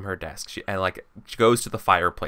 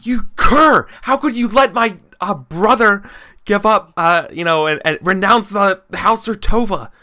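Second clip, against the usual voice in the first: high, loud, furious shouting; furious noblewoman; 11 semitones higher, 8 dB louder than usual. Acted. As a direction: scream the accusation at him, outraged. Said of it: "she's really mad".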